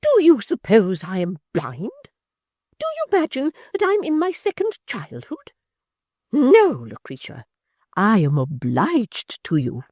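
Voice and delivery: high, loud indignant matron; a wounded lady turning cold and grand; high, loud offended dowager; Missus Chick, outraged. A narrator's reading.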